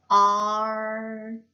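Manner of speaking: Art with a long held vowel, then a separate t; sounded-out word. Shown as a demonstration. Teacher sounding out A R T, stretched Art. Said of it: "A R 무슨 소리 나요?".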